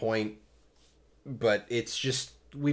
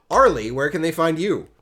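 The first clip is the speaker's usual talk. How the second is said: bright host call; 6 semitones higher, 10 dB louder than usual. Real, not acted. Host calling on co-host.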